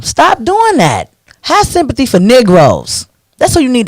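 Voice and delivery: exasperated plea. Real, not acted. Urging the sympathetic crowd to stop, exasperated high plea. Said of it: "Sympathetic Negro syndrome".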